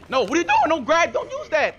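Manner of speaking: high loud protesting, slowing down; real exasperated yelling at teammate. Real, not acted. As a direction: stop your teammate in disbelief. Scolding teammate using the box.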